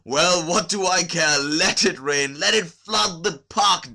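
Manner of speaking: loud, high, manic laughing rant; hysterical laughter. A narrator's reading. Kukin defying the rain and his ruin, hysterical laughing shout. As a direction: laugh wildly like a man past caring. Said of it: "laughing hysterically".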